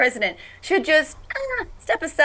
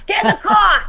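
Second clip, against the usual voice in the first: put-on loud, high yell at the kids; Jersey mom yelling; 9 semitones higher, 6 dB louder than usual. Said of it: "Jersey fishwife voice".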